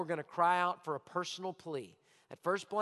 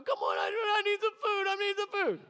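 much higher, whining plea for food; hammed-up hungry husband begging; mock whiny husband; 13 semitones higher, 3 dB louder than usual. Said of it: "your husband starts going".

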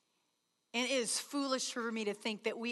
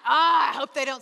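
laughing mock complaint; 10 dB louder than usual. Mocking bored retreat-goer, laughing.

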